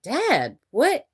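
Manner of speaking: put-on high, disbelieving teen protest; incredulous teen son. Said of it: "And Rowan was like".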